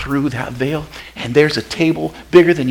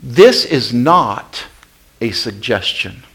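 normal talking voice

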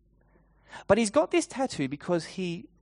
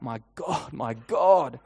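a plea that swells into a loud cry; anguished cry out; 5 dB louder than usual. A narrator's reading. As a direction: call out to God, rising in force. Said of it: "you hear Jesus cry out".

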